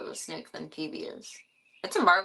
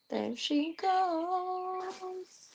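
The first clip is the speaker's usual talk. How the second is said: high, drawn-out sing-song; playful commentary; 12 semitones higher, 3 dB quieter, much slower than usual. Real, not acted. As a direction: stretch it out high and slow. Watching a pet take off.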